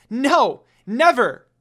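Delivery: high, loud defiant cry; yelled. Acted. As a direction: scream the refusal as she runs. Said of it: "Kagome yelled".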